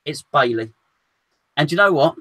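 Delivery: usual speaking voice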